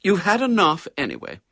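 firm mother, no argument. A narrator's reading. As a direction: put your foot down with the boy. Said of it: "said Missus Brown firmly".

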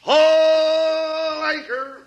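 drawn-out shout; long bellowed order. Acted. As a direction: call it out slowly. Shouted nautical command.

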